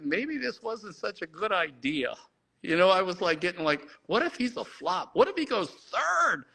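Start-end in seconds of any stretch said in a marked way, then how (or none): 0.0-2.1 nervous doubt. Retold or imitated. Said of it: "and I'm thinking". 4.1-6.4 freaking out. Retold or imitated. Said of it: "And I'm freaking out, and I'm nervous".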